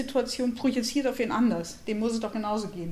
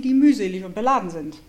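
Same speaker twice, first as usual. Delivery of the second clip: ordinary delivery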